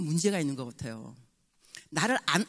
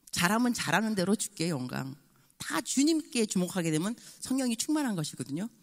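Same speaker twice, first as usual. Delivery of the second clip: usual speaking voice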